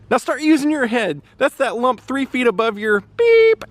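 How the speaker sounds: scolding coach. Retold or imitated. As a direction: imitate a coach chewing out a player. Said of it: "And he goes".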